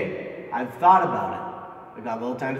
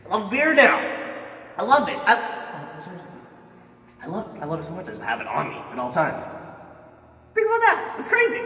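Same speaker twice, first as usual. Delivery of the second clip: too much caffeine; 5 semitones higher, faster than usual. Acted. Jittery, over-excited about beer.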